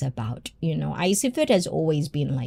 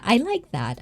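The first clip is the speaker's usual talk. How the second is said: ordinary talk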